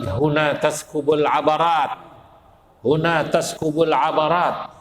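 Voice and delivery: intoned, preacher reciting the Prophet's words; recited Arabic quote. Acted. Preacher quoting the Prophet to the congregation, solemn recitation. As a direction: chant it out slowly like a hadith.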